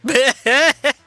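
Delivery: short loud burst of laughter; real laugh. Real, not acted. Player amused by the villain's poster, loud laugh.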